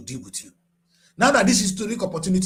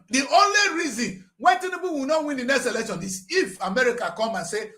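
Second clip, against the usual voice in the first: ordinary talk, nothing marked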